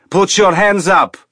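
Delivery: high, loud command at gunpoint; shouted order. A narrator's reading. Colonel shouting at Holden at gunpoint, loud order. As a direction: bark it at him over the snow. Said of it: "Ilitch shouted".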